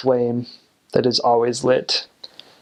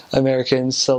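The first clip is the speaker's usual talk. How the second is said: normal talking voice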